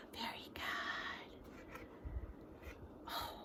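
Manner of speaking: slow hushed praise; whispering. Real, not acted. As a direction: gasp then whisper it. Gasp then whispered praise.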